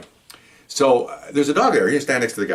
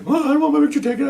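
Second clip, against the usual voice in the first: much higher, whining mock refusal; whiny arrestee; faster than usual. Arrestee refuses his mugshot, high whiny voice. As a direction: whine like a stubborn big man.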